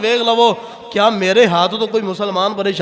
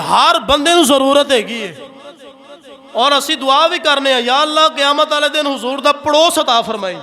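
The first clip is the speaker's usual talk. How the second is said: raised, ringing sermon voice; impassioned preaching; 4 semitones higher than usual. Real, not acted. Preaches with rising force. (पुरजोश तकरीर, उच्ची आवाज़ विच)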